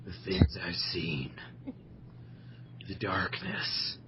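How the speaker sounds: low, soft gravelly Batman voice; Dark Knight voice. Pretend. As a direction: brood like Batman. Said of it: "getting off on this Dark Knight voice".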